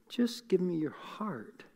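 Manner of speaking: slow, breathy hush with no voice; whispered plea. Acted. Preacher voicing God's plea to the congregation, whispered. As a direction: whisper it slowly like a secret longing.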